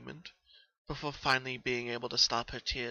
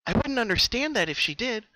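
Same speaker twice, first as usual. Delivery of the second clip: higher, louder bubbly pony voice; Pinky, subdued; 10 semitones higher, 8 dB louder than usual. Acted. Pinky telling Twilight when the dreams began. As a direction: explain it eagerly, a little worn.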